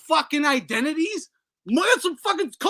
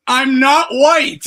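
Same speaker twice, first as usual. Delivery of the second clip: loud, slow mocking proclamation; loud slowed-down mock quote; 2 semitones higher, 12 dB louder, much slower than usual. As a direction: blare it out in mockery. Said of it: "He goes".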